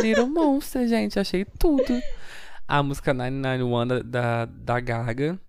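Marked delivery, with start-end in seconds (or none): 0.0-2.0 excited. Retold or imitated. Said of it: "eu parei e falei".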